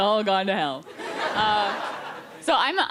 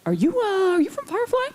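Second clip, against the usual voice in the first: high, halting fan approaching a celebrity; mock timid stranger, pitched way up; imitating awkward fan; 9 semitones higher than usual. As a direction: put on a hesitant high voice, rising at the end. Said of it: "a guy came up, and he was like".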